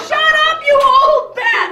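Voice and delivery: loud, high, slow mock outburst; hammed-up furious yell. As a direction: let it fly, imagined. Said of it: "I'm like".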